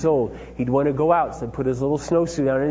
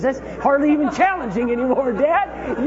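put-on much higher, boastful boy's voice; cocky son; 11 semitones higher, 3 dB louder than usual. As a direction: brag like a confident kid. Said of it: "He goes".

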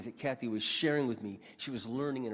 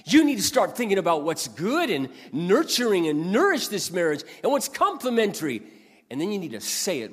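higher, louder stern rebuke; God rebuking; 6 semitones higher, 8 dB louder than usual. Retold or imitated. God scolding Mark about his marriage, loud and stern. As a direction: lay into him like a stern father. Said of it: "And he just said".

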